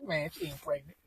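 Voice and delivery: lightly voicing his laid-back mother; a son imitating his mom dismissing the pregnancy in a low, soft voice; mom's voice. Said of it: "She forty-five".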